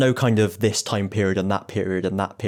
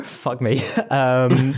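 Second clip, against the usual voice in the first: ordinary delivery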